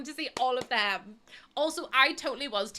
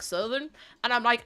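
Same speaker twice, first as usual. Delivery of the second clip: ordinary talk, nothing marked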